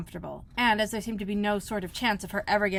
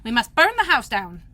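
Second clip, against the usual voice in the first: louder grim decision; Rabbit's voice; 3 semitones higher, 9 dB louder than usual. A narrator's reading. Rabbit telling the others to burn the house, louder. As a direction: announce the drastic plan.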